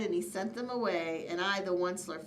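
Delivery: ordinary delivery, nothing marked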